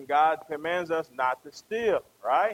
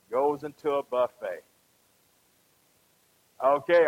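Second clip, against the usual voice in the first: ordinary talk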